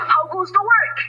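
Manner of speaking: high, loud farmer call. A narrator's reading. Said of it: "called the farmer".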